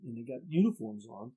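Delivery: usual speaking voice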